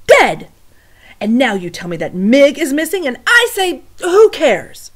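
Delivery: loud, high indignant rant; angry rant. Acted. Louise ranting to the cook, loud and high. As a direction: build to a loud, high outburst.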